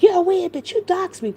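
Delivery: high, loud imitation of an insult; mock accusing quote. Vlogger retells Allie accusing someone of doxing, mock accusing.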